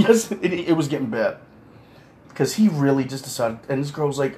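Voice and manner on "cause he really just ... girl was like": usual speaking voice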